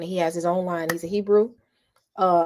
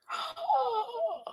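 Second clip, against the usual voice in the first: delighted gasp; 8 dB quieter than usual. Real, not acted. Reacting to remembered food.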